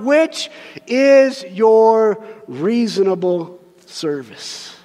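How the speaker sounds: slow, loud, lofty King James reading; grand scripture reading. Acted. Preacher reading the King James verse to the congregation, lofty. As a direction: intone the old verse with weight.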